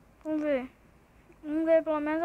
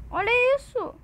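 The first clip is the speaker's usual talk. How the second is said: high, loud exclamation of disbelief; indignant outcry; 6 semitones higher, 6 dB louder, slower than usual. Real, not acted. Streamer exposing a hacker to viewers, indignant outcry. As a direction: call it out, outraged.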